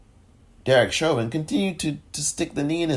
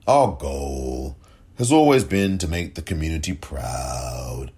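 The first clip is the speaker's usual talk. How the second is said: lower, slow pompous mock of the police chief; lower, slow sneering imitation of a press statement; mock official voice; 7 semitones lower than usual. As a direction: parody the hollow official line with contempt. Mocking the statement again.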